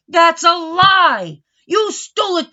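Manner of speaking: loud and high, slow accusing yell; angry shout. Acted. Boys yell at the old gentleman, angry. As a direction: shout the lie down. Boys' heated quarrel.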